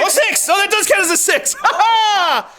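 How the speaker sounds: delighted yelp at a lucky six; excited. Real, not acted. Game host thrilled at a lucky dice roll, yelping.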